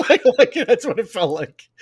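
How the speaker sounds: amused, words breaking into laughter; laughing. Real, not acted. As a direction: talk through a high laugh. Laughing at own reshoots joke.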